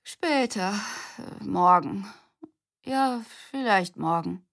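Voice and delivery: put-on sleepy old folks yawning out an answer; drowsy, lightly voiced grandparents; yawning wave-off. A narrator's reading. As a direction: put off with a yawn. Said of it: "winkten sie freundlich gähnend ab".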